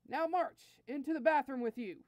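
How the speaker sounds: march him off to punishment; commanding prince, no argument allowed; stern command. Acted. Prince orders minion to punishment.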